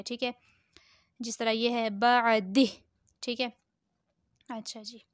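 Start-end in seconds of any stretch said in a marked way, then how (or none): 1.9-2.7 बअदिह cut short on "dih"; waqf stop ending. Shown as a demonstration. Teacher applying the pause rule, बअदिह stopped on h. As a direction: end बअदिह on h without the long "hee". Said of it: "इसी तरह से इस पे वक्फ़ करेंगे".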